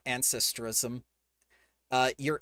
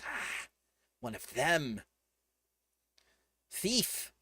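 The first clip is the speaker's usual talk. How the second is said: hissed creature accusation; hissing; 4 dB quieter, slower than usual. Acted. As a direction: hiss it like a wary creature. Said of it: "the Hesper hisses and says".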